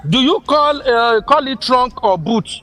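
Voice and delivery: normal talking voice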